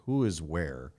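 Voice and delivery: ordinary talk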